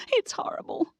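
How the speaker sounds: very high, breathless whispered wail; terrified whisper. Acted. Amelia, after shrieking about the spiders, in a very high terrified whisper. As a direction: gasp it out in horror.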